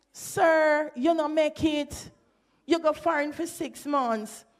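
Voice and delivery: mocking verdict. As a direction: scoff at him for failing to get the twang. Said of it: "meant that".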